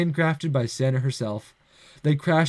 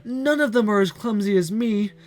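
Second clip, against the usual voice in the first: tearful; 7 semitones higher than usual. Acted. Girl Santa in despair.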